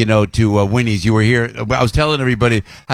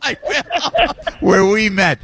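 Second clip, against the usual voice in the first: laughing while talking; 7 semitones higher, much slower than usual. Real, not acted. Host laughs through his reply.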